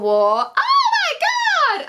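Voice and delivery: a high, long squeal with a laugh; excited squeal. Real, not acted. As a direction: squeal with delight at the news. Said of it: "super fucking hyped".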